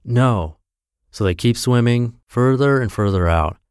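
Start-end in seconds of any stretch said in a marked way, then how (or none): none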